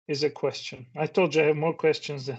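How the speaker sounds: usual speaking voice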